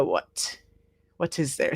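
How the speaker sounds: barely voiced what; puzzled murmur. Real, not acted. The teacher reading a student's answer, puzzled murmur. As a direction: mutter it to herself while reading.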